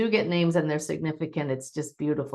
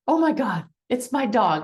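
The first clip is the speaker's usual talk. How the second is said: higher, louder amazed outburst; retold excited client; 6 semitones higher, 4 dB louder than usual. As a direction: voice the client's astonished reaction. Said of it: "And my client said".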